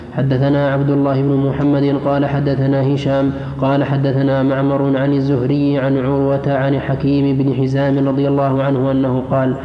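ordinary talk, nothing marked

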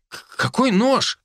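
high, startled "К-, какой нож"; startled stammer. Acted. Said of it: "встрепенулся Глицевич".

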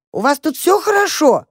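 high-pitched call over the din; shouting over bells. A narrator's reading. Mother checks on mice through clanging bells, shouting. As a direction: raise your voice to carry over bells. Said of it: "прокричала она сквозь колокола".

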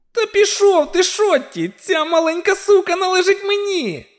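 very high-pitched spiteful dwarf voice; high, furious little villain; hateful dwarf. A narrator's reading. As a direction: shriek it high, claiming your prey. Hateful dwarf shouts at his enemy.

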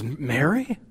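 high, loud incredulous disbelief; can't believe the choice, rising; astonished question. Acted. Gabriel reacting to God's choice of Mary, astonished. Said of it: "And he says".

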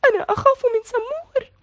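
put-on high, timid child's voice hiding behind a friend; scared little girl. A narrator's reading. Razan hiding behind Zein, afraid of the dog, high timid voice. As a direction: squeak it out, frightened of the dog. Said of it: "اختبأت رزان وراء زين".

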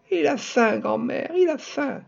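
put-on high, loud child's cry of protest. Little girl crying out to her grandmother, high and loud. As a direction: shout it out, a little girl pleading. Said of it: "en criant".

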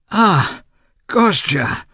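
high, loud cry of glad surprise; light up at the sight of him; joyful recognition. A narrator's reading. Brother greeting his long-absent brother with sudden joy. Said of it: "suddenly recognizing his brother".

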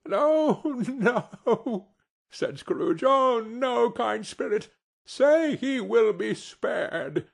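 put-on old man crying out in dismay; desperate plea. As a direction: beg the spirit for the boy's life. Scrooge begs the spirit.

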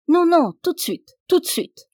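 high, loud insistence; impatient order. A narrator's reading. Lord ordering his servant out at once, loud impatient command. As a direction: cut him off and demand it now.

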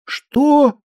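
high, startled question; astonished. A narrator's reading. Wife baffled by husband's babble, astonished. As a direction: pitch it right up in disbelief. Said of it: "удивилась Кукуша".

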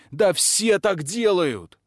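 high, loud and slow outburst; indignant exclamation; exclaimed. A narrator's reading. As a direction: cry it out in protest. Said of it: "вскричал Пашка".

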